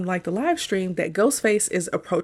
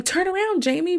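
high-pitched imitation of urging the screen; quoted movie-watcher's plea; Randy's urging; 10 semitones higher than usual. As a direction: call it out high, as a mock warning. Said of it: "Randy keeps saying".

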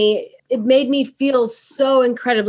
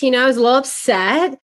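ordinary talk, nothing marked

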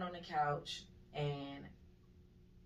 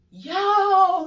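very high, very loud "yo"; long piercing shout; full-out yell; 21 dB louder than usual. Retold or imitated. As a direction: scream out for help from a nightmare. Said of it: "I'm trying to yell".

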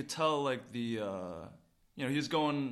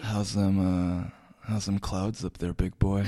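low, louder, hammed-up macho drawl; lower-voiced joking tough guy calling a listener big boy; mock macho voice; 6 semitones lower, 4 dB louder, slower than usual. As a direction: tease the pilot like a swaggering bro. Teasing pilot listener in voice.

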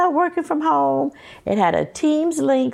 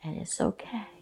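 calm crisis counselor; 3 semitones lower, 14 dB quieter than usual. Acted. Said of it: "in your therapist's voice".

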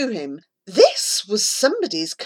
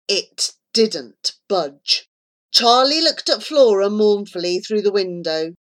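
ordinary delivery

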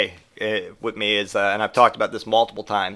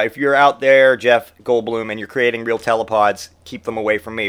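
ordinary talk

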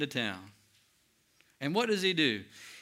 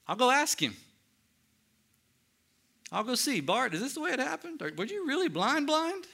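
curious eager questioning; 10 semitones higher than usual. Retold or imitated. Congregant imagines questioning Bart.

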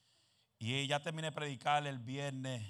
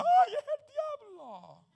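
high, slow squeal of a frightened churchgoer; mocking a scared believer; 12 dB louder, much slower than usual. Preacher mocking people who see the devil in a curtain. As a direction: drag out the "Ay" in mock terror. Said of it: "Se mueve la cortina en la casa".

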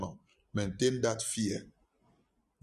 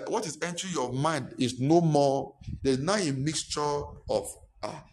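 usual speaking voice

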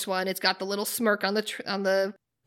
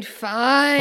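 begrudging; 3 semitones higher, 6 dB louder than usual. Pretend. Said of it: "The Begrudging".

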